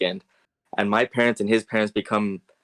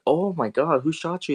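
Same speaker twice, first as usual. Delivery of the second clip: mock alarm in a raised pitch; imitated parental freak-out; 7 semitones higher than usual. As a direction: play shocked parents, voice pitched up. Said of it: "freaking out".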